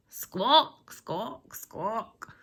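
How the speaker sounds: seagull squawks. Acted. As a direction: caw like a startled seagull.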